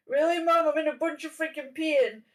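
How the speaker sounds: imitating a hurt kid protesting; exasperated son. Injured brother complains to mom.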